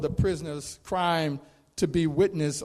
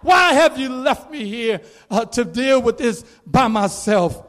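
mild despairing cry; 6 semitones higher, 5 dB louder than usual. Acted. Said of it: "in his despair and feeling totally abandoned".

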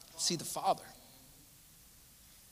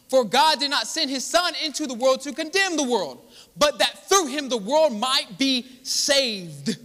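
raised-pitch, emphatic preacher's delivery; impassioned preaching; 6 semitones higher, 3 dB louder than usual. Real, not acted. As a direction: drive each phrase home to the congregation. Preaches at full force.

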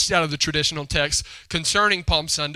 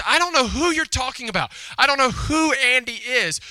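higher-pitched exasperated denial; mock exasperated brother; 8 semitones higher, 3 dB louder than usual. Brother answers his pestering little sister, exasperated. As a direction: imitate a brother fed up with questions.

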